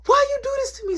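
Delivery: high, loud hurt cry of betrayal; upset accusation. Retold or imitated. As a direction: hurl it at him, wounded. Tracy confronts them, upset.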